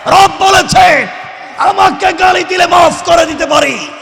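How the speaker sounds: high, loud shouted exhortation; full-force preaching. Real, not acted. Preacher rousing listeners to say the takbir, full-force preaching. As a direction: rouse the crowd at a shout.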